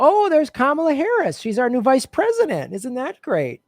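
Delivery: sarcastic cheer. Acted. Sarcastic cheer over donor list.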